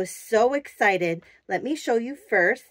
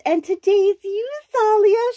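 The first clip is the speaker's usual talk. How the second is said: higher and louder, thrilled; delighted reveal; 10 semitones higher, 5 dB louder than usual. Real, not acted. Presenting the doll to viewers, higher and louder with delight. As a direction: announce her like a proud mom.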